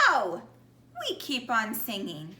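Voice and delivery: ordinary delivery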